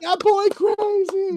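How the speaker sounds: hammed-up awestruck bystander; amazed onlooker. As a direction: marvel at the gazelle like a stunned fan.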